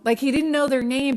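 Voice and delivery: ordinary delivery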